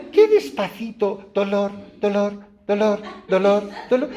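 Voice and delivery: high, laughing cries of pain; patient howling after the plaster is ripped off; mock pain cry. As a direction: yelp it like a hammed-up patient.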